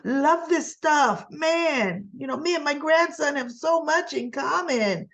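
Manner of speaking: a hint of a giddy old man, slowly savoring it; mock Tucker gushing. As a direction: gush over the juice in a teasing imitation of Tucker. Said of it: "I'm surprised he didn't say".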